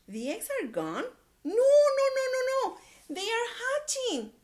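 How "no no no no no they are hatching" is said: high, loud excited no no no; excited Piggy. A narrator's reading. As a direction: squeal the correction with glee. Piggy correcting Gerald excitedly.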